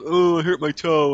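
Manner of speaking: whiny mock of his friend; mocking whine. Co-host mocks the host's whiny stories. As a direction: mimic the complainer. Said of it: "You're always telling stories of".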